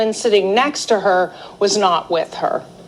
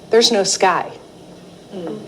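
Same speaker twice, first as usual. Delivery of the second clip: ordinary delivery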